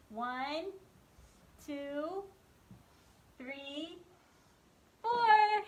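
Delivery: slow count-along with a big loud four; teaching a child to count. Shown as a demonstration. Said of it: "Can you count with the, me".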